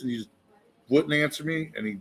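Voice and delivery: ordinary delivery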